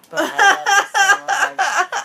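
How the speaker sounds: high, loud, slow wail of I love you so much; laughing breakup plea, high and slow; mock breakup plea. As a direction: wail it like being dumped. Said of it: "what you say when you're getting broken up with".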